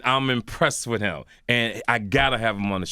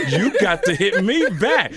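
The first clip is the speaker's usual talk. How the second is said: louder, higher, slow mock complaint; emphatic retold plea, raised and drawn out; hurt pleading, retold; 7 semitones higher, 6 dB louder than usual. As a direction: put your foot down, half laughing. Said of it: "I'm in my feelings".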